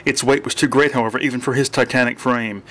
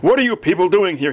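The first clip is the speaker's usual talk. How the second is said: raised, higher-pitched demand; sharp demand; 7 semitones higher, 4 dB louder than usual. A narrator's reading. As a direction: demand an answer urgently. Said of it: "he demanded".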